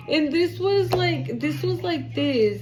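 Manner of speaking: usual speaking voice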